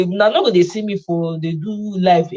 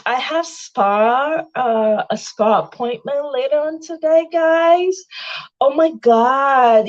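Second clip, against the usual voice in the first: posh socialite; 5 semitones higher, slower than usual. Pretend.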